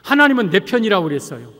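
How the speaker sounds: normal talking voice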